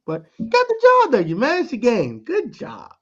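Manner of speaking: high praise. Real, not acted. Praising Jacoby.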